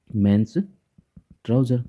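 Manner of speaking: slowly spelling out an example, drawn out; slow example phrase. Shown as a demonstration. Teacher slowly reading an example to the class. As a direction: say each word slowly for students. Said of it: "जैसे कि".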